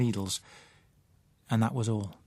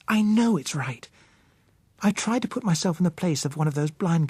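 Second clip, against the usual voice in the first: put-on higher young woman's voice, firm and convinced; Josella, determined; 6 semitones higher than usual. A narrator's reading. Josella convincing Bill to care for blind girls, firm higher voice.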